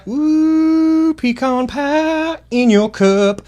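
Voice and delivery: high-pitched excited whoop. Real, not acted. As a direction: cheer it out high and slow. Excited cheer over coffee.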